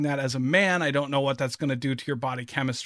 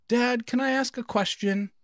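son's voice; 9 semitones higher than usual. Retold or imitated. Dad voices his young son.

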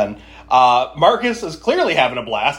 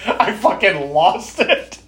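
ordinary talk, nothing marked

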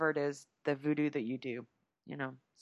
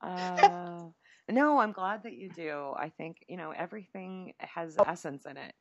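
normal talking voice

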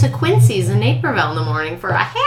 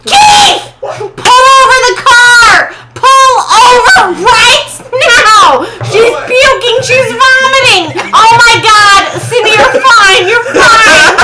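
high, loud frantic yelling at dad; screaming at her husband to pull over, frantic loud voice; mom's panicked shouting; 12 semitones higher, 6 dB louder, slower than usual. Retold or imitated. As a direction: shout it like a panicking mom in the car. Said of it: "And my mom said".

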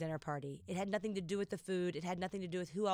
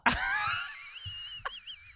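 high, bursting laugh. Real, not acted. Reaction to the poem's punchline.

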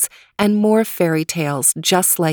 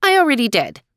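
dismissive shrug; 6 semitones higher, faster than usual. Acted. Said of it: "she said with a shrug".

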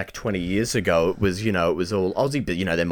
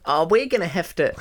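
a light, higher take on Reggie; Reggie impression; 7 semitones higher than usual. Friend asks for Reggie, a light higher impression. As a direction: lightly mimic the host's announcing. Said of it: "do a Reggie impersonation though".

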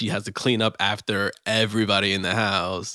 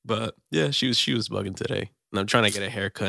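ordinary talk

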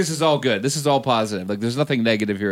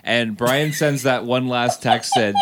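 normal talking voice

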